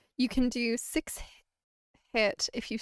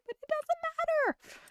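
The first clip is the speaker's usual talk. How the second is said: high squeaky voice. Acted.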